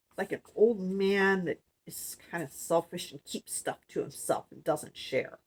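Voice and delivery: ordinary delivery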